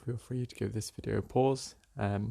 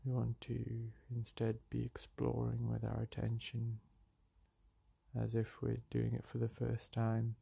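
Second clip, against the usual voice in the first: calm, unhurried meditation voice with long pauses; soothing meditation guide, leaving space between phrases; guided meditation; 4 dB quieter than usual. Acted. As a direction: guide them gently back to the breath.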